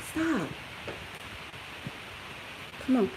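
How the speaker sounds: ordinary talk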